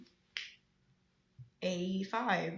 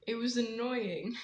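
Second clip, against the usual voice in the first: ordinary talk, nothing marked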